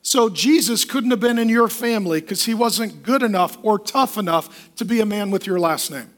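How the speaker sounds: ordinary talk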